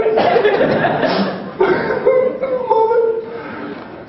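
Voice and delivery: long high cackle of laughter. Real, not acted. Telling the crowd the slam story, laughing high.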